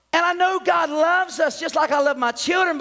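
normal talking voice

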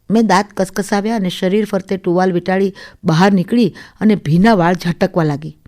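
plain reading voice

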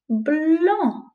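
Blanc said high and falling. Shown as a demonstration. Teacher models the title, Blanc high and falling. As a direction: lift Blanc up, then let it fall. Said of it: "It's called".

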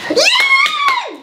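a high, delighted yeah; excited cheer. Real, not acted. Therapist cheering the child's correct guess, excitedly. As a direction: celebrate the right guess.

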